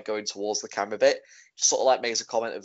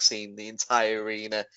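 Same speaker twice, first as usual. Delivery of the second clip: ordinary talk, nothing marked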